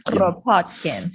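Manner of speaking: Kropotkin with a rolled R, slow; rolled R Russian accent. Pretend. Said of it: "I can roll my tongue as well".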